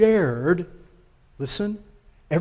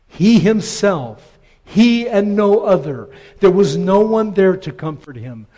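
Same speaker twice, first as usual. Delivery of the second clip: full-force preaching; 6 dB louder than usual. Acted. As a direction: preach it out to the whole church.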